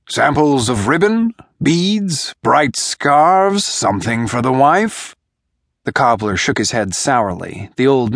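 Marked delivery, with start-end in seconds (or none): 0.1-5.2 higher, pleading hawker's whine; whining peddler. A narrator's reading. A light wheedling sales whine. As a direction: peddler whines his wares to the men. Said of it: "he whined".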